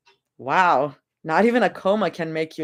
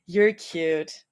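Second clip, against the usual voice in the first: normal talking voice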